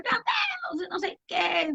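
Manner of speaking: high, wailing cry of someone hit by bad news; despairing cry. Imitating a grieving person crying out to God at the news. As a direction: wail it out as if you just heard of a death.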